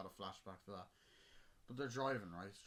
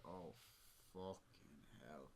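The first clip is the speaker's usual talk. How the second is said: exasperated groan; 6 semitones lower, 10 dB quieter, much slower than usual. Retold or imitated. Exasperated reaction to stranger.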